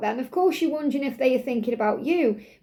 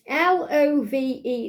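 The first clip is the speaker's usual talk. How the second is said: slow spelling; slower than usual. Shown as a demonstration. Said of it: "spells out the card word".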